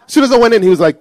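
ordinary talk, nothing marked

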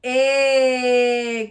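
"A" as a long held "ih"; middle sound alone. Shown as a demonstration. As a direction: stretch "A" like the vowel in win. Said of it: "to the middle sound".

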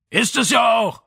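shouted up from a floor below; father shouting up through the house; voice from downstairs. Acted. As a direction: call it up from the kitchen below. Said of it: "kam Vitos Stimme ein Stockwerk tiefer".

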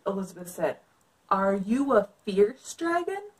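put-on lively read-aloud for kids; story narration. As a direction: read it like a picture book. Reads picture book aloud.

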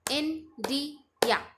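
India in three slow, separated beats; three-beat syllables. Shown as a demonstration. Teacher clapping out syllables, India split in three. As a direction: pause after each syllable of India. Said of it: "three times clap करेंगे".

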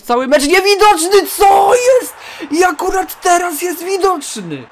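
rising into high, loud panic; alarmed shouting. Real, not acted. Gamer watching an unseen rival break through, alarmed shouting. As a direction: cry out in disbelief as danger appears.

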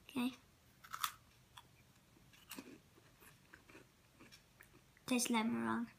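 talking with mouth partly full; speaking between bites; chewing. Real, not acted. Seen 0: Bit meringue at -5s; still chewing early, mouth emptier later.

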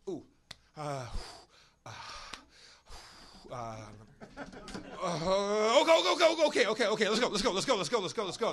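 high, loud, panicked stammer of okays; frantic mock O J, stammering to get going; panicky O J. Retelling O J panicking to Kato, high and loud stammer. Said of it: "And O J goes".

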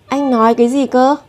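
ordinary talk, nothing marked

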